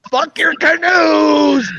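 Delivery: furious punchline shout. Retold or imitated. Telling a joke to friends, the punchline yelled. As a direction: bellow the curse, drawing it out.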